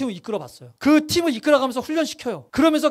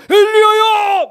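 calling 엘리야야 in a huge, much higher, louder voice; booming call; 10 semitones higher, 9 dB louder, much slower than usual. Pretend. Pastor voicing God calling Elijah, booming shout. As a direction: thunder out the name like God from the sky. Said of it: "막 엄청나게 크게 막 모두가 깜짝 놀랄 만큼".

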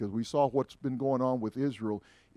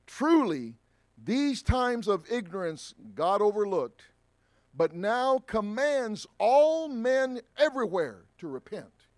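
solemn scripture reading; 6 semitones higher, 4 dB louder, slower than usual. Acted. Reading scripture aloud to congregation.

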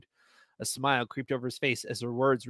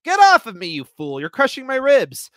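high, loud strained yell; pinned man croaking at the stranger, high loud yell; croaked yell; 9 semitones higher, 9 dB louder than usual. Acted. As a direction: shout in pain from under him. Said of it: "I croaked".

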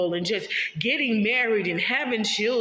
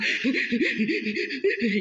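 real laugh; 5 semitones higher than usual. Real, not acted. Laughing at own ugly-cry description.

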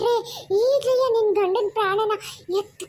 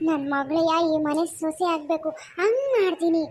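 menacing ghost voice. Acted. Possessing spirit threatens Sushma.

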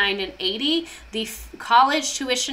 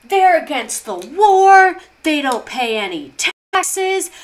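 higher, louder, a hammed-up politician ranting; mock Reagan rant; 6 semitones higher, 6 dB louder than usual. Imitating Reagan railing against Berkeley students, higher and louder. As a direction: sneer at the hippies like a campaigning governor. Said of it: "they're like".

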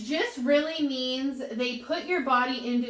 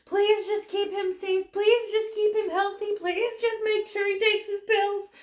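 higher, earnest pleading prayer; retold prayer; 9 semitones higher than usual. Praying for her husband on his flight, earnest higher plea. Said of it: "praying on the way home".

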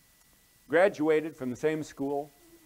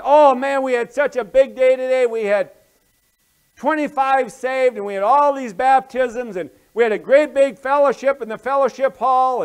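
loud, high, gushing excitement; excited boasting; 9 semitones higher, 14 dB louder than usual. Acted. Pastor phones his friend about a big day, excited. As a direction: gush it out at full volume, piling up good news.

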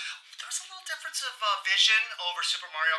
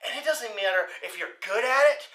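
ordinary talk

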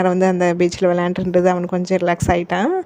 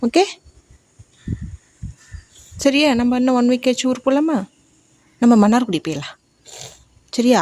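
higher sing-song promise; 5 semitones higher than usual. Real, not acted. Mother promising her crying son a trip, high coaxing. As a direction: coax him with a bright lifted voice.

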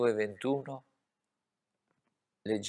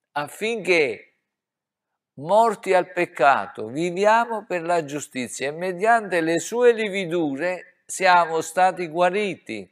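loud, high pulpit delivery; impassioned sermon climax; fervent preaching; 8 semitones higher, 10 dB louder than usual. Real, not acted. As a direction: drive the verse home, full force. Preaching at full force.

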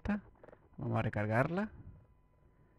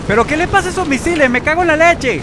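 much higher, louder furious outburst; outraged yell; 12 dB louder than usual. Real, not acted. Gamer yelling in outrage at wasted missiles. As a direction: scream your disbelief at the missiles.

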